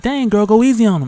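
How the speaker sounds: loud, high mock alarm; playfully retold startled plea; alarmed protest. Retold alarm during the fight.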